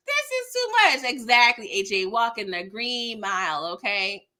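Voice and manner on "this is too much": much higher exasperated cry; exasperated outburst. Retold or imitated. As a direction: throw it up high in disbelief. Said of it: "I'm like".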